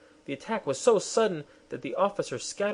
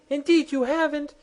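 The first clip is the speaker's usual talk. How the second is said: very high, indignant snap; queen retorting to the resigning private; sharp retort; 6 dB louder than usual. Acted. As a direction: cut him off. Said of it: "retorted the Queen".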